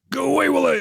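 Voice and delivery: high shouted command; battle shout. Shown as a demonstration. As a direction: bark the order over gunfire. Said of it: "I'll be doing some things like saying".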